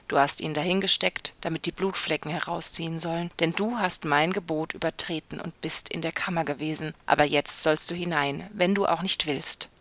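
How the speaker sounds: ordinary delivery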